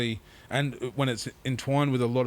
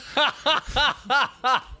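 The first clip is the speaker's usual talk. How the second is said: loud high staccato laugh; helpless laughter; 13 semitones higher, 3 dB louder than usual. Retold or imitated. Laughing at Keith objecting to being called a dog, high cackling. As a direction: crack up in a high-pitched burst.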